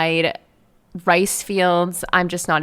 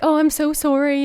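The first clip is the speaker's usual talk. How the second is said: high, louder gushing apology; apologetic Canadian; 8 semitones higher, 4 dB louder than usual. Retold or imitated. Stuck biker apologizing to helpful strangers, high gushing apology. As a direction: fluster out an overly polite sorry. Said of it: "apologetic Zoe".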